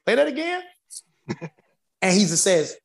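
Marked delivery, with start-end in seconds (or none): 0.1-0.6 high, eager retold ask; mock eager producer call; excited request. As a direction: ask to hear it again, thrilled. Troy excitedly asks for replay.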